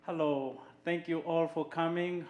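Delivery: normal talking voice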